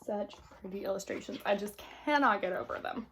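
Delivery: ordinary delivery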